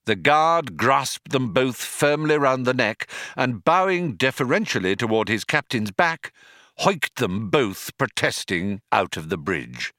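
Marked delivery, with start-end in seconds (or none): none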